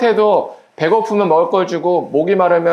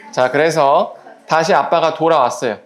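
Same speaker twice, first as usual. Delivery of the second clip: ordinary delivery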